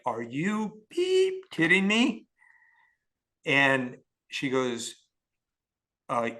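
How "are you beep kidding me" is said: higher, slow, drawn-out disbelief; incredulous outburst. Retold or imitated. Told the tech lady, incredulous higher slow outburst. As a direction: stretch out the outrage, pitched up. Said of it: "And I'm like".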